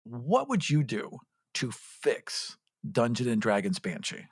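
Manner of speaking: usual speaking voice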